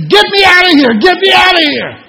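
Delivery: high, loud shout to be let out; panicked yell. Retold or imitated. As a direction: yell like you're trapped and can't breathe. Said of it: "I started yelling to them".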